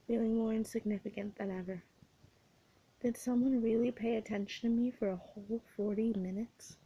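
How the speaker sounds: ordinary delivery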